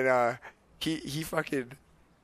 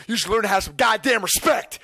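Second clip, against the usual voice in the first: loud, raised-pitch dad telling-off; angry scolding; 7 semitones higher, 5 dB louder than usual. Retold or imitated. Dad scolding the drive-thru worker, angry shout. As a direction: shout it as an indignant reprimand.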